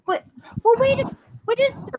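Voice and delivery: high, puzzled double take; sudden high disbelief; surprised. Retold or imitated. Retold surprise at online search.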